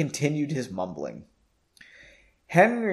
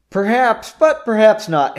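retort; 8 semitones higher, 9 dB louder than usual. A narrator's reading. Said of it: "Henry retorted".

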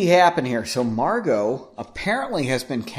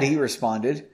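plain reading voice

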